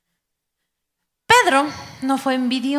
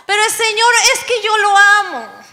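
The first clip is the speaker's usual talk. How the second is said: súplica fingida; 6 semitones higher than usual. Retold or imitated. Joven suplica por su novio.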